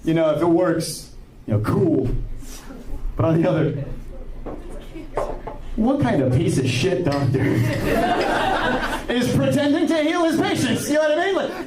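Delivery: normal talking voice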